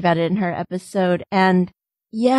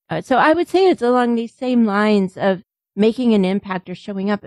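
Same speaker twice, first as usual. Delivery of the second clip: ordinary talk, nothing marked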